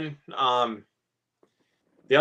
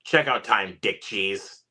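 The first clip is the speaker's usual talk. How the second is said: quoted line; 7 semitones lower, much slower than usual. Retold or imitated. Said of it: "this quote".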